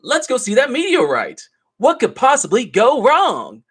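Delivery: high, eager dumb-guy enthusiasm; hammed-up naive glee; jokey high voice, clueless and thrilled; mock excited. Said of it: "the guy's like".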